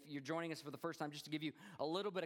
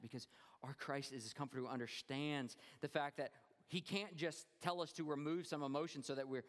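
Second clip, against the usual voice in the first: ordinary talk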